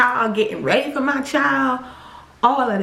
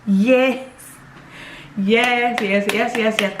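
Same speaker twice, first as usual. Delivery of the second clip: ordinary delivery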